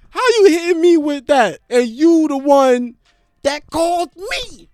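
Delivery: high, loud outrage, slowing at the end; indignant rant. Real, not acted. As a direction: drag out FaceTime in disbelief.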